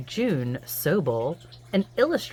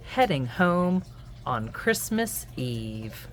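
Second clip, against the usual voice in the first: lively sing-song picture-book read-aloud; reading to children; 7 semitones higher, 3 dB louder, slower than usual. A narrator's reading. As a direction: read it to little ones at bedtime.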